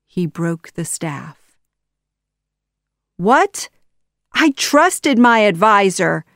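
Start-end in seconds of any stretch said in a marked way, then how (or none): 3.2-6.2 louder, higher outraged disbelief; shocked cry. A narrator's reading. Betrayed Sultan learning the truth, shocked cry. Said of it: "the Sultan cried".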